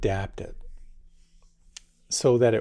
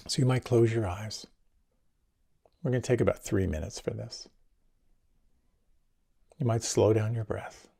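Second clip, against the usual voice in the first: guided meditation. Acted. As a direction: ease the listeners into calm.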